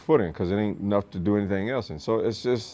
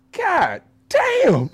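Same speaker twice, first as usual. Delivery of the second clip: impressed drill instructor; 7 dB louder than usual. Retold or imitated. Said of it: "You go".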